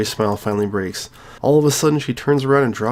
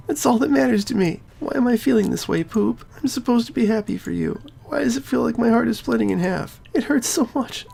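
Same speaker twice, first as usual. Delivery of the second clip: Sayori voice; 12 semitones higher than usual. Acted. Sayori telling her friend it hurts, high and tearful. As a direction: voice her heartbreak, high and hurting.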